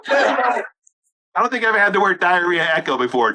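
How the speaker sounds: ordinary delivery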